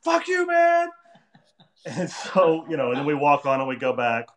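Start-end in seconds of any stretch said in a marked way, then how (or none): 0.0-0.9 high-pitched retold street yell; mock angry shout. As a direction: yell it after a passing cab. Retold yelling back at cab.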